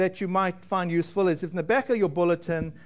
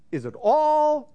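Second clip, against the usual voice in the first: "all" lifted high and drawn out slowly; stress on all; 7 semitones higher, slower than usual. Shown as a demonstration. Pastor asking the congregation which word stands out, stressing "all". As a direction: lean hard on "all" as the key word. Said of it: "what words are highlighted in that sentence".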